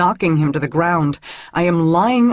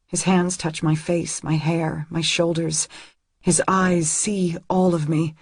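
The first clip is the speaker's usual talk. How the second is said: usual speaking voice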